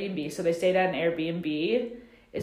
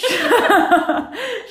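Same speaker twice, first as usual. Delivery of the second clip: laughing; 13 semitones higher, 8 dB louder than usual. Real, not acted. Cracking up at quote.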